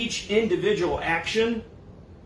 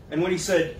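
usual speaking voice

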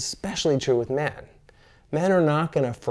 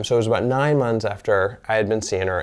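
ordinary talk, nothing marked

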